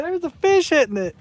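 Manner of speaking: high, squealed mock excitement; laughing, high-pitched retold exclamation; excited reenactment. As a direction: mimic your own false alarm at a bite. Reenacts mistaking grass for a bite.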